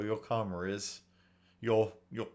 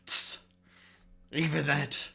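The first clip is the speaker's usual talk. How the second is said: bandit complaining, a bit louder; gruff-tempered bandit; 4 semitones higher, 5 dB louder than usual. Acted. Bandit haggling with an old man over ink, indignant. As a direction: play an aggrieved bandit, worked up.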